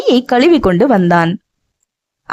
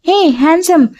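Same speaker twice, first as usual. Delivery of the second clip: put-on childlike call; 8 semitones higher than usual. A narrator's reading. Childlike woman calls.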